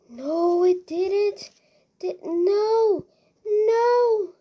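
high, whining wail of denial; wailing protest. Real, not acted. Player seeing the house wrecked, wailing protest. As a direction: plead in disbelief, pitched high. Despairing at the damage.

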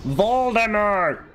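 high, drawn-out cry of VOLDEMORT; calling out. Pretend. Player scolding the game character, high drawn-out cry.

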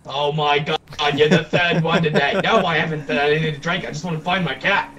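usual speaking voice

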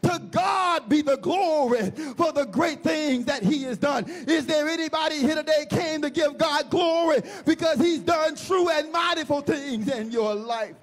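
rousing church preacher building in drive and volume; full-force preaching. Acted. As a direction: preach it hard, rallying the congregation to testify.

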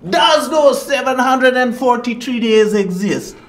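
loud, high repeated challenge; impassioned declaiming. Acted. Guru questioning present existence, impassioned loud declaiming. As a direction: cry out the question. Guru's rhetorical outburst.

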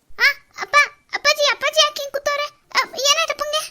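high, loud tearful cries; sobbing alarm over father; crying in panic. Acted. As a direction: daughter crying out for her collapsed father. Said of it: "ಯಾಕೆ ಪುಟ್ಟಿ ಅಳ್ತೀಯ?".

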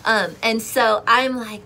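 normal talking voice

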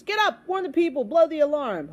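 high, loud commands; urgent shout. Acted. Boy wakes the snoring trumpeter, shouting urgently. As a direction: rouse a sleeper urgently.

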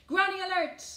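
loud, high alarm call; rallying cry. A narrator's reading. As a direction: sound the alert to the whole gang. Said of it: "cried Mavis Jones".

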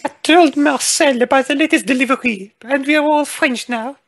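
high-pitched character voice, far above the natural voice. Acted. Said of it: "Forgot her voice".

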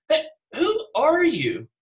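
authoritative demand. Retold or imitated. Religious leaders confront John, authoritative and demanding. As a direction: bustle up and challenge him with authority. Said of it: "all authoritative looking".

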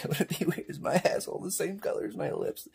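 hushed, confiding whisper; whispered aside. Acted. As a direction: whisper an embarrassing question. Hushed crude aside about own body.

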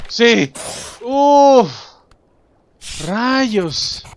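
loud high yelps of hope then frustration; excited outbursts. Real, not acted. Player reacting to a close call, loud high yells. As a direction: yell each word out. Near miss while playing.

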